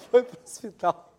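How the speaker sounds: breathy words lost in laughter; barely voiced, laughing it out. Real, not acted. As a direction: giggle through the punchline. Laughs through punchline.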